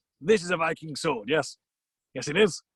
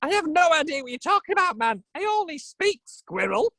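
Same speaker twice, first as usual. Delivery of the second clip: high-pitched squirrel character voice; Ratatosk; 9 semitones higher than usual. Acted. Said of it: "which means in squirrel".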